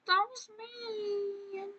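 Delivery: high, soft and slow, a pouty little whine; soft, slow, high sulky whimper; mock hurt. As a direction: play the wounded victim. Reacting to being yelled at.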